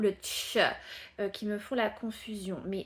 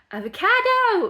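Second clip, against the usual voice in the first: very high, loud Avocado; joking shout; 13 semitones higher, 13 dB louder than usual. Acted. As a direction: shout it out as a joke for Myriam. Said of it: "Comment on dit un avocat".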